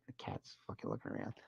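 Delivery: breathless whisper. Real, not acted. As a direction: whisper as if not to spook the cat. Creepy ghost-hunt moment.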